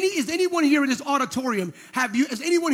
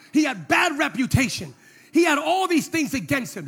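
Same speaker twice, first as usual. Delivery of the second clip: ordinary delivery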